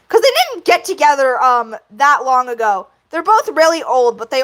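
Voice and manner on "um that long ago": usual speaking voice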